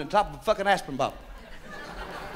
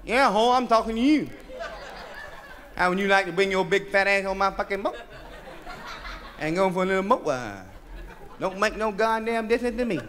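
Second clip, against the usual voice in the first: cocky come-on; 3 semitones lower, 4 dB quieter than usual. Acted. Cocky man hitting on woman.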